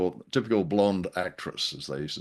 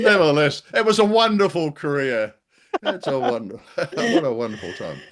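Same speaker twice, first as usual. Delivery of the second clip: 1 ironic cheer; 6 semitones higher, 6 dB louder than usual. Real, not acted. Ironic claim after joke.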